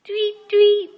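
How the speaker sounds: high, loud tweet tweet bird voice. Pretend.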